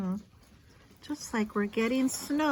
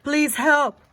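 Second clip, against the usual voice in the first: pleading mayor; 6 semitones higher, 12 dB louder than usual. A narrator's reading. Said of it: "he said".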